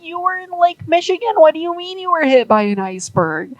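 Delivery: high, fast, exasperated disbelief; the harbor tugs baffled by Zug's story; incredulous tugs. Acted. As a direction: push back in exasperated confusion. Harbor tugs react to Zug in disbelief.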